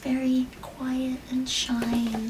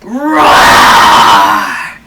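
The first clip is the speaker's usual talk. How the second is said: loud lion roar with full voice; big roar; 11 semitones lower, 17 dB louder than usual. Acted. Mother opens the book with a loud lion roar. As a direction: roar like a lion to start the story.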